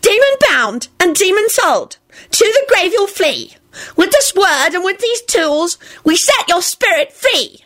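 high-pitched bossy exorcist reciting a spell; shrill incantation. A narrator's reading. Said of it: "Dorcas shrilled".

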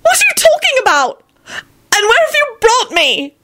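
very high, distraught questioning; shriek. A narrator's reading. Elizabeth demanding answers from Lupin, shrieking. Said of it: "she shrieked".

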